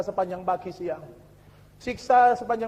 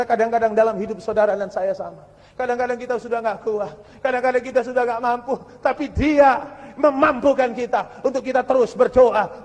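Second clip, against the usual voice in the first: usual speaking voice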